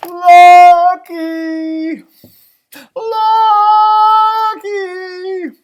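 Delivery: long, high, loud call; drawn-out shout, stretching each syllable. A narrator's reading. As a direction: holler for a lost dog. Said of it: "I called Lucky's name".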